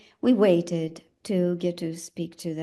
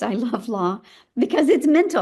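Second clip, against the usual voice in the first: laughing; 3 semitones higher, 5 dB louder than usual. Real, not acted. Laughing about loving law.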